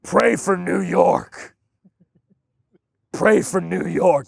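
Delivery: slow, solemn mock prayer for the city; lightly voiced pious stranger, drawn out; mock online commenter. Mocking a Jersey commenter who doesn't live there, slow and solemn. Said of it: "New Jersey guy seventeen or nineteen seventy-five".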